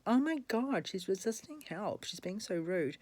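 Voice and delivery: hammed-up shocked tutting; mock indignation. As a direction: sneer it like a scandalised busybody. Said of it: "people get shirty going".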